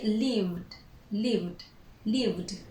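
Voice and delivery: "lived" as one syllable, V then D; careful "lived", voiced V into a final D; silent E. Shown as a demonstration. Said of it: "We do not pronounce the E".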